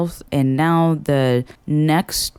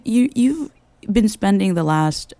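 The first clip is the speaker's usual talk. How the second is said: normal talking voice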